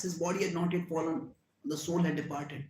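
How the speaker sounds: ordinary delivery, nothing marked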